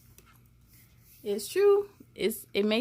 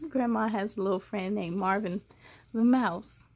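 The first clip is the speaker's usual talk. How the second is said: ordinary delivery, nothing marked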